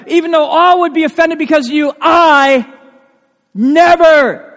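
loud, high, drawn-out never; proud loud boast; emphatic vow. Retold or imitated. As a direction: swear it with full force. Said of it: "Very emphatic".

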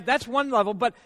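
ordinary talk

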